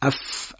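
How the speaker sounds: اف blown out with breath; اف said like blowing out a candle. Shown as a demonstration. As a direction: blow air through the اف. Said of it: "به حالت فوت کردن ادا بشه".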